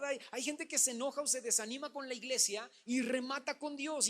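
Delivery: ordinary talk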